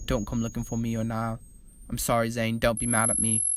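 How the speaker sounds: low, soft letter reading. Acted. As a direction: read the note quietly, as if hearing Liam. Reading Liam's farewell note aloud.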